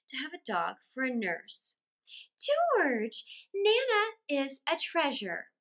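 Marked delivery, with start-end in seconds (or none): 2.4-5.5 high lady's voice, gently insistent; slow, pitched-up reassurance; reproving wife. A narrator's reading. Wife defends the dog to her husband, high and insistent.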